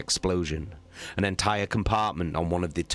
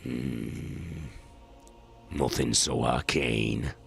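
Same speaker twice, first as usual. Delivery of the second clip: lower, slow knowing captain; battle captain; 6 semitones lower, much slower than usual. Acted. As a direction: muse it slowly, low.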